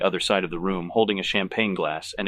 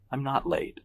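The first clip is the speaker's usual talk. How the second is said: higher-pitched, mild protest; barely protesting; 6 semitones higher, 4 dB quieter than usual. A narrator's reading. Guest answering Evelyn's scolding, barely protesting. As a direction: object without any real force. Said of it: "barely protesting".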